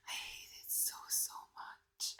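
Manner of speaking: breathy real whisper, barely voiced. Real, not acted. Whispering to the camera about hating the loss. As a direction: hush it out as if it hurts too much. Sobbing over Madeline's death.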